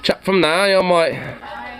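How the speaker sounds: high, mocking British imitation of the man; mock British accent. Streamer mimicking the homeowner, mock British accent. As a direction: parrot him in a put-on British accent.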